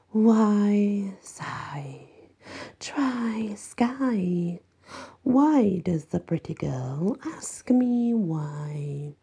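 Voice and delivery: slow, breathy taunting whisper; eerie whisper. Acted. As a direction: hush it like a mad riddle. Mummy teasing in riddles.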